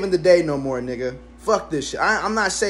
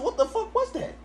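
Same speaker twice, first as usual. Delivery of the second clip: startled; 7 dB quieter than usual. Retold or imitated. Retelling the shock again.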